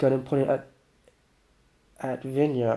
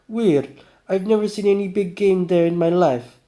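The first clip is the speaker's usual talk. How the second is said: put-on higher, louder swaggering drunk voice; boastful drunk guy; 5 semitones higher, 6 dB louder than usual. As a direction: brag like a tipsy hunter. Vanya voice.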